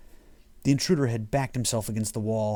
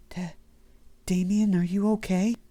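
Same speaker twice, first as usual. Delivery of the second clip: Mary's worried voice; 9 semitones higher than usual. A narrator's reading. Mary frightened, higher voice.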